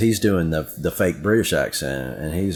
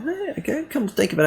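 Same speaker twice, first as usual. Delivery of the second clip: much higher, jumping up in surprise; sudden realization. Retold or imitated. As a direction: act out the moment it dawns on you. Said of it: "I'm like".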